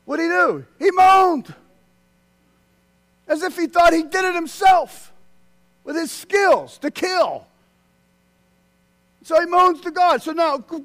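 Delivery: loud, high preaching. Real, not acted. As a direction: press the point hard from the pulpit. Preaching at full force.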